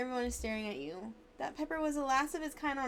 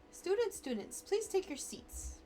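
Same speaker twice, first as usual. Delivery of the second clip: professor dog voice; 7 semitones higher, slower than usual. Acted. Dog professor addresses class.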